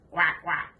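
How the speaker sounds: playful quacking duck imitation. Shown as a demonstration.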